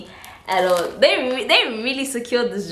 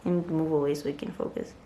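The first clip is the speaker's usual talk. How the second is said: near-whisper, low and soft; hushed, confiding aside; hushed focus; 5 semitones lower, 11 dB quieter, faster than usual. Real, not acted. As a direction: drop to a murmur to focus on details. Settling down to show details.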